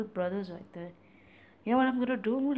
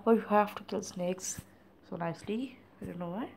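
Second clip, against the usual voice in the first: ordinary talk, nothing marked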